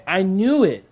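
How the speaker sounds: ordinary talk, nothing marked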